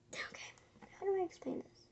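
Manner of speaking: soft unvoiced thinking aloud; whispered to self. Real, not acted. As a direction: whisper it to yourself while working it out. Muttering while planning a drawing.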